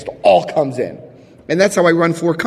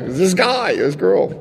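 ordinary delivery

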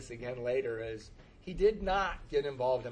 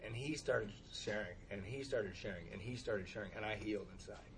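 fading quiet; 6 semitones lower, 11 dB quieter than usual. Real, not acted. Relief as another shares.

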